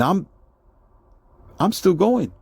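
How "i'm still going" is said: ordinary talk, nothing marked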